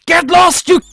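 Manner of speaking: re-enacted full-force scream, high and very loud; retold scream. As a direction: scream it out as if scaring off a rat. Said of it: "and I just screamed".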